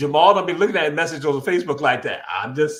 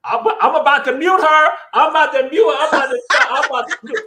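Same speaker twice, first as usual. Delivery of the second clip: laughing; 9 semitones higher, 7 dB louder than usual. Real, not acted. Laughing while threatening to mute her.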